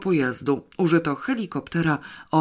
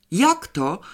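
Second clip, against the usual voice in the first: higher, indignant outburst on „jak to”; indignant cry; 5 semitones higher, 4 dB louder than usual. A narrator's reading. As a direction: exclaim in mild outrage. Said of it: "krzyknął ktoś z oburzeniem". (okrzyk oburzenia)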